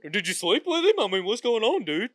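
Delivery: loud, high-pitched comic grilling; mock complaint. Customer mimicking the host's rant at customer service, mock complaint. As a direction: fire off an exaggerated interrogation.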